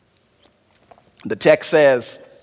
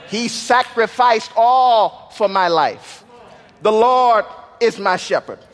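high, ringing pulpit build; preaching at full force; 4 semitones higher than usual. Acted. Preacher building toward the cross, full force. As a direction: carry the church up to the climax.

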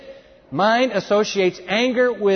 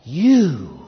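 "you" drawn out long and louder; weighty, accusing emphasis on "you"; stress on you; 4 dB louder than usual. Acted. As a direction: lean hard and long on "you". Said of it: "the Greek is emphatic".

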